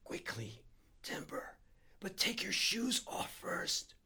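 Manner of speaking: hushed urgency, squeezed out slowly; hoarse whisper rising into strain; straining half whisper. A narrator's reading. Harry urges his friend to come shoeless, straining half whisper. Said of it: "straining half whisper Harry was using now".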